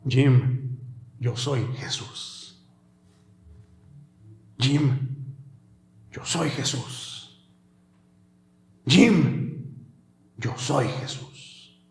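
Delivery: slow, hushed whisper of Jesus; soft whisper. Acted. Jesus speaking intimately to Jim, slow soft whisper. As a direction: breathe the words gently.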